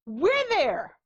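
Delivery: usual speaking voice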